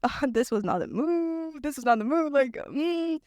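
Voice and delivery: slow, drawn-out mock lament; drawn-out dismay. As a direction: stretch it into a dismayed little whine. Reacting to a too-short haircut.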